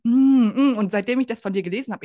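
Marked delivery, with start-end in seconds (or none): 0.0-0.8 loud mock moan of enjoyment over food; savoring moan. Host imitating how she moans while eating, loud pleased hum. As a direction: hum with pleasure like a first bite of something delicious. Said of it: "Ich stöhne die ganze Zeit beim Essen so".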